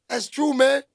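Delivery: ordinary talk, nothing marked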